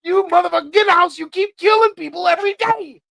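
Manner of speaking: hammed-up rant at a killer dog, high and loud; yelling at the dog. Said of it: "that would be my mental every time".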